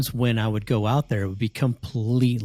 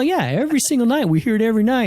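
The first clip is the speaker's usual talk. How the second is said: mom; 12 semitones higher, 5 dB louder, faster than usual. Retold or imitated. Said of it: "And she's all".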